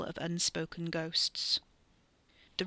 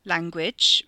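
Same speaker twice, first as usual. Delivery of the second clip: loud one-word scolding; sharp retort; 9 dB louder than usual. A narrator's reading. Brother retorts to sister's swearing, sharp scolding. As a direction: retort it loudly, as a reprimand. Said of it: "Rez retorted".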